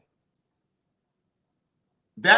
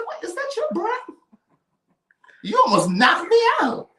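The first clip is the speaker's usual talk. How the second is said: much higher, pinched disbelieving squeal; shocked high squeak; 3 dB louder than usual. Retold or imitated. Retelling a friend's bad breath, a high shocked squeak. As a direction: gasp it out like you can't believe the smell. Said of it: "I said".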